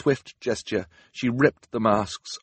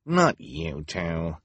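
impatient; much slower than usual. A narrator's reading. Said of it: "Esme said impatiently".

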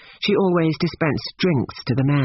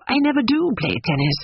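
painfully bewildered; 8 semitones higher than usual. A narrator's reading. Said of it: "painfully bewildered".

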